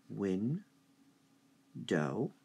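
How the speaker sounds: window broken as win, then dough; teacher splitting window into two syllables; split into syllables. Shown as a demonstration. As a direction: say window slowly in two beats. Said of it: "how many syllables?".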